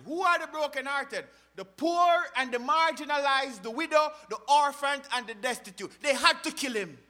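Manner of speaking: loud, high-pitched preacher's cry; forceful preaching. Acted. Preacher listing the brokenhearted to congregation, impassioned forceful preaching. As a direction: hammer out each item from the pulpit.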